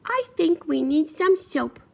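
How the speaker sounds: high, loud, slow piggy voice; piggy character. Acted. Piggy suggesting soap to the others, high piglet voice. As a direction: pitch it up and draw it out as a piglet.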